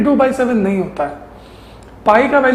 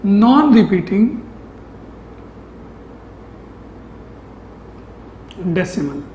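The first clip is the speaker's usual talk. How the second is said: slow dictation; 5 dB quieter, much slower than usual. Shown as a demonstration. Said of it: "जो आपको जानना चाहिए as a student".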